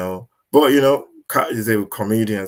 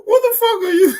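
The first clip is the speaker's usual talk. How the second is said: much higher, louder shocked yell; shocked high outburst; 8 dB louder than usual. Retold or imitated. Kat reacting to the Illuminati call, shocked high yell. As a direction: squeal it out in disbelief. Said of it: "So Kat will go".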